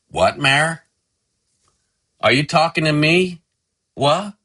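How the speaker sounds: high, rising questions; calling back puzzled. Acted. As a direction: call back as if you missed it. Husband calls back, not hearing her.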